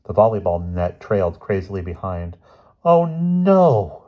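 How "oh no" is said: high, loud dismayed groan. A narrator's reading. Bruno watching the net drift off, loud dismayed groan. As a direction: groan as the net floats away. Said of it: "groaned Bruno".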